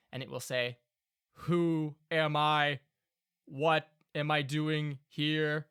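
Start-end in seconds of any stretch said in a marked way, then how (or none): 1.3-5.7 slow, louder confused golem; slow bewildered construct voice. Acted. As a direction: speak as a newly woken golem, slow and lost. Golem speaks, GM voice.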